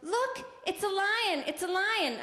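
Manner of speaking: put-on a light toddler voice; imitating a child. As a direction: play the excited little niece. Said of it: "and she would go".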